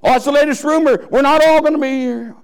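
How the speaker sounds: high, loud scoff at the rumor; a soul in hell brushing off false hope, high and loud; scoffing damned soul. Acted.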